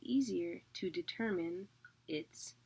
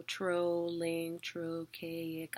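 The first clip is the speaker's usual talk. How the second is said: slow; much slower than usual. Shown as a demonstration. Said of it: "teacher links word to meter".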